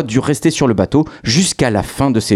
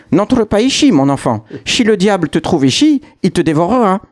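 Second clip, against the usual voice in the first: put-on old woman's warning; 7 semitones higher than usual. Old lady warns the hero.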